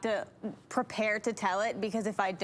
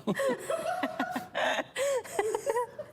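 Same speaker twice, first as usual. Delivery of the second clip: long high-pitched laugh; helpless sustained laughing; cracking up for real; real laughter; 13 semitones higher than usual. Real, not acted. Listener laughs at long joke.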